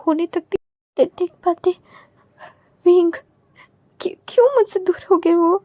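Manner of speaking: high, breaking voice through tears; grieving son weeps over his parents, voice breaking; crying. Acted. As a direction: sob out the grief for lost parents. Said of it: "वांग जी रोने लग गया था".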